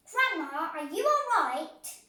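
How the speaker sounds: high, loud worried call. A narrator's reading. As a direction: call out anxiously.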